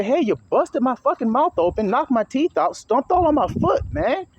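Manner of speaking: high, complaining victim voice; mock complaint. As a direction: voice the hurt person protesting. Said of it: "when they say".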